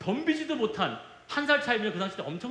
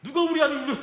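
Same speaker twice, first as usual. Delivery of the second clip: angry father; 6 semitones higher, faster than usual. Retold or imitated. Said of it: "아버지가 잠옷 바라고 뛰쳐나오더니".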